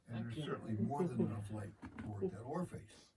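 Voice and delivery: normal talking voice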